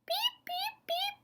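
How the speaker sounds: very high, soft little beeps; tiny piping frog peeps, soft and far up high; high peeping. Acted. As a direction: peep softly in a tiny high squeak. The peeper's call, acted.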